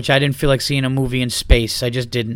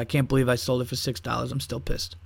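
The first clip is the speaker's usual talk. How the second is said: grumbling; 3 semitones lower, 10 dB quieter than usual. Real, not acted. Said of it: "I'm still pissed about it".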